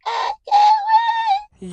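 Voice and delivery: very high, slow squeal. Real, not acted. Driver squealing with anticipation for the pickup. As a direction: stretch it up into a squeal.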